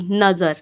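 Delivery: नज़र ending on a plain r; no vowel after the r in नज़र; stopped ending. Shown as a demonstration. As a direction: say नज़र stopped short of "raa". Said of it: "नज़रा नहीं पढ़ना आपने".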